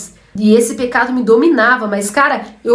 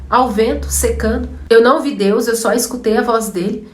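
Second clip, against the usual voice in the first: normal talking voice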